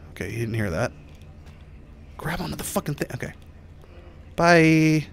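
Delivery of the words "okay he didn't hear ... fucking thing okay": softer hushed whisper. Real, not acted. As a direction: breathe it out quietly while hiding. Hiding, trying not to be heard.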